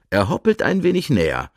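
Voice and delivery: plain reading voice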